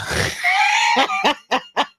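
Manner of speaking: high shrieking burst of laughter; big laugh. Real, not acted. Cracking up at a TikTok clip.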